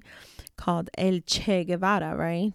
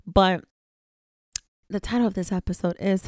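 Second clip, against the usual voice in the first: ordinary delivery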